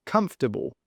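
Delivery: "comfortable" stressed on COMF; KUMF-tuh-bul, weak after the first beat of "comfortable"; first-syllable stress. Shown as a demonstration. Teacher repeats after explaining, stress on COMF. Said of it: "The stress is on the first".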